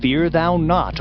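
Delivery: ordinary talk, nothing marked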